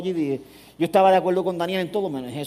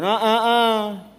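slow drawn warning "Ah, ah, ah"; mock scolding; rebuke; 5 semitones higher, 4 dB louder, much slower than usual. Shown as a demonstration. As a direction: stop the thought in its tracks, wagging. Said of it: "tú le dices".

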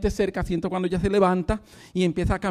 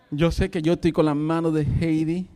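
ordinary delivery

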